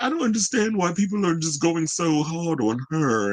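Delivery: high, put-on British accent, quoting John Lithgow; playful British accent impression; mock British accent. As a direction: mimic him in an exaggerated British accent. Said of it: "I don't know why I'm doing a British accent".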